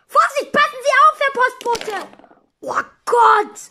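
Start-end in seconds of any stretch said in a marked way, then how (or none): 0.1-2.1 high, fast warning call; warning shout. Acted. Warning the postman, quick high shout.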